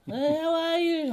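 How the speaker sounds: high, drawn-out, loud hello; mock gushing greeting. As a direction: squeal it slowly at a long-missed guest.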